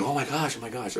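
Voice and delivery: hushed imitation of shocked bystanders; women's murmuring. Women watching the arrest, imitated murmur. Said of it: "you hear women, everybody's talking".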